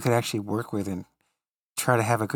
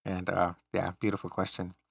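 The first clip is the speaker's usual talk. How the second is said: ordinary delivery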